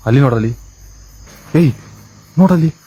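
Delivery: real startled call-out; urgent alert. Real, not acted. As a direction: alert your partner to sudden movement. Alerts partner to something moving.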